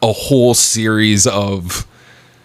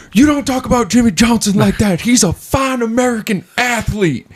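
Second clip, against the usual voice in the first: put-on thick Southern accent. Bar patron defending his favorite driver, thick Southern accent. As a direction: scold like an offended Southerner. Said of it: "some guy with a thick Southern accent".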